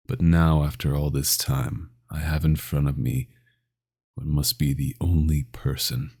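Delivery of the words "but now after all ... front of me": ordinary delivery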